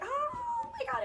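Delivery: very high, drawn-out joyful cry; thrilled squeal. Retold or imitated. Rider on an ATV with boyfriend, squealing in delight. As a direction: squeal as if riding over bumps.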